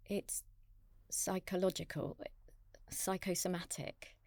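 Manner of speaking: whispery, halting admission; hushed, tentative near-whisper; hesitant confiding. Acted. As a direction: confide it under your breath. Izzy hesitantly confides to Clive.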